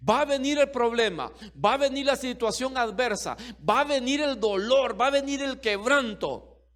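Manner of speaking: loud fervent sermon, high and driving; preaching at full force. Acted. Preacher assuring the church God covers their children, full preaching. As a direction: preach it hard over the crowd.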